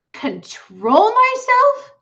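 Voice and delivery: high, loud, slow indignant yell; yelling girl. A narrator's reading. Fern yells back at her father in outrage. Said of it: "yelled Fern".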